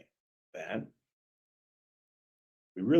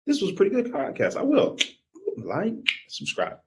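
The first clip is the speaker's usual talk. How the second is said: chirpy fan deciding to like, subscribe; mock pleased listener; 10 semitones higher, 4 dB louder than usual. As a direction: play the pleasantly surprised listener. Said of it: "sometimes you're like".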